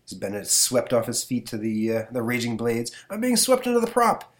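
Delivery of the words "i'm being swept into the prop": panicked high cry. Acted. Professor in peril.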